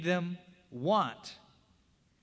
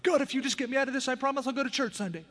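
desperate bargaining; 10 semitones higher, faster than usual. Retold or imitated. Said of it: "We make bargains with God".

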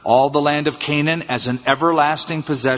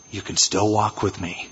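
gentle voice of God; 7 semitones lower, 6 dB quieter than usual. Acted. God speaks to old Abram.

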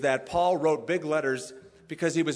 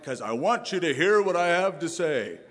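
mimicking the friend; 3 dB louder than usual. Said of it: "just like his style".

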